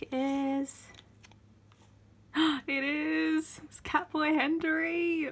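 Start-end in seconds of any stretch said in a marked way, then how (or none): 2.3-5.3 high, slow thrilled cry; real delight over a card; excited squeal. Real, not acted. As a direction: gasp then squeal with joy. Finds wanted card.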